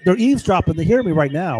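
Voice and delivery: ordinary talk, nothing marked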